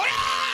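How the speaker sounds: sudden high "Ah!" yell; jump-scare yell. Retold or imitated. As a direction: leap out of the bush screaming. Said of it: "and I go".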